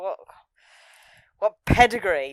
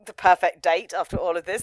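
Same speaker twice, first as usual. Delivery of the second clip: ordinary talk, nothing marked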